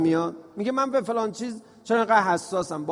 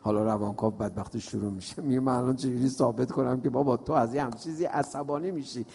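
weary aside; 8 semitones lower, 6 dB quieter than usual. Retold or imitated. Psychoanalyst's weary complaint.

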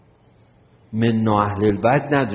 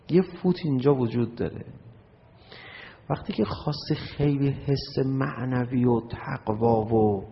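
ordinary talk

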